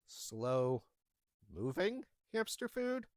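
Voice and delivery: slow, drawn-out disbelieving repeat; incredulous echo. Retold or imitated. Pet store clerk echoing a baffling request in disbelief. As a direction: repeat it back like you can't believe it.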